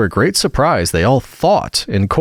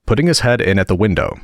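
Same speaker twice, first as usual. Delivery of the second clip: plain reading voice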